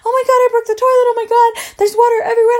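high, rapid tearful wail; hammed-up crying freak-out; mock crying panic. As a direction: panic through tears, words tumbling out high and fast. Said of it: "I'm, like, crying".

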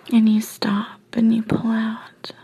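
usual speaking voice

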